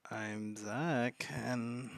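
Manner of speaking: ordinary talk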